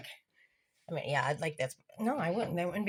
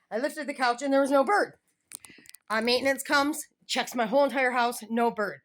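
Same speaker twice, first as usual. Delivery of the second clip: ordinary talk, nothing marked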